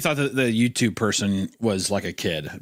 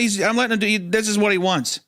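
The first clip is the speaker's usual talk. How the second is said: pitched-up imitation of the lady; high mock neighbor voice; 8 semitones higher than usual. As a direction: mimic her breezy excuse, higher.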